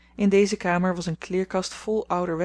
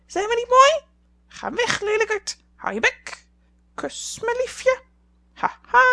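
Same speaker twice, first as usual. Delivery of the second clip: screechy high squawking parrot; 7 dB louder than usual. A narrator's reading. Parrot preening at itself in the mirror, squawking high and loud. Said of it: "om te lachen of uit te roepen".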